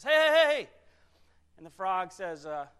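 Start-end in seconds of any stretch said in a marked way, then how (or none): 0.0-0.7 high, very loud hail; calling out. Acted. Scorpion calls to the frog at the stream, loud calling out. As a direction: shout to stop someone in their tracks.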